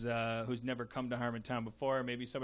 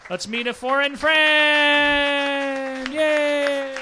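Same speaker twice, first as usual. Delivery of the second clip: hammed-up host cheer; 11 semitones higher, 14 dB louder, much slower than usual. Host hypes up the guest.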